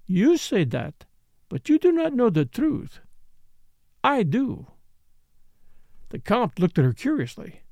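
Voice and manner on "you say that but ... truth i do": put-on higher, accented dancer voice; knowing foreign dancer. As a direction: play the accented dancer, confiding and emphatic.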